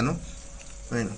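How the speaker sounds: normal talking voice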